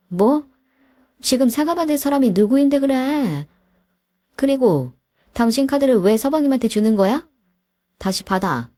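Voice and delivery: high, sharp outraged questions; furious wife. Acted. Wife rounds on her husband, high and outraged. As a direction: snap back at him.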